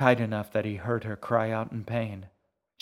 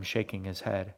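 plain reading voice

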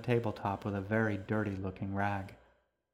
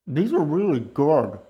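put-on higher, louder, slower girl voice; young girl voice; 6 semitones higher, 9 dB louder than usual. A narrator's reading. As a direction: gush like a happy kid. Girl praises her waffles.

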